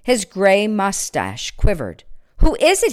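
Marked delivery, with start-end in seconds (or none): 2.4-2.9 high, loud startled cry. Acted. Old man woken by a voice in the dark, startled cry. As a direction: jump at the voice and call out. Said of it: "he cried".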